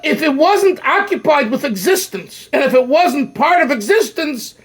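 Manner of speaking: ordinary talk, nothing marked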